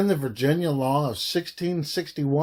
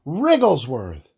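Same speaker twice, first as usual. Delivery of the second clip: relishing the odd name, a bit louder; playful exclamation; 4 dB louder than usual. Real, not acted. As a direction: savour the funny name as you call it out. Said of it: "Fun to say".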